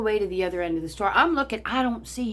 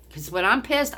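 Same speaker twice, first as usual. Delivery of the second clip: usual speaking voice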